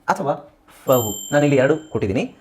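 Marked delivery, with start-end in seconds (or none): none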